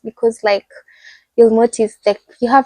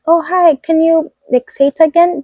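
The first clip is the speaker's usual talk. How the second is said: higher, friendly asking voice; acted-out courteous stranger; imitated polite request; 7 semitones higher than usual. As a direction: lift the pitch, model a nice way of asking. Models how people should politely ask.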